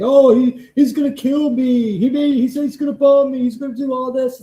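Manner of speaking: high, loud mocking whine of a fake victim; mocking whiny quote. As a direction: sneer it out as a hammed-up crybaby. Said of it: "Why fucking make a lie saying".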